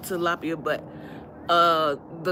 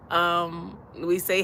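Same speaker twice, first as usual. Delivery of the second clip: ordinary delivery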